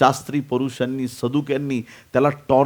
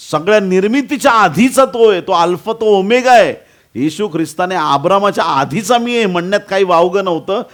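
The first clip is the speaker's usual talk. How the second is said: loud, high-pitched proclamation; impassioned sermon climax; full-force preaching; 8 semitones higher, 7 dB louder than usual. Acted. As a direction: proclaim it ringingly to the congregation.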